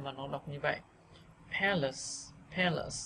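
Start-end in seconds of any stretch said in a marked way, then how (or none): 1.6-3.0 "palace" with open e in "pa", weak second vowel; first-syllable stress. Shown as a demonstration. Teacher recapping vowels, "palace" stressed on PA. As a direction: stress "pa" and let "lace" fall. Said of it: "lại đọc thành âm E".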